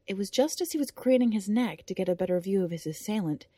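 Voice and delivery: ordinary delivery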